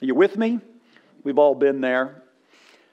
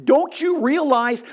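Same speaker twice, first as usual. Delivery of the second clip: incredulous cop; 9 semitones higher than usual. Retold or imitated. Policeman punchline.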